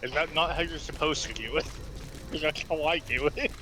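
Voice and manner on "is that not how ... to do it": ordinary delivery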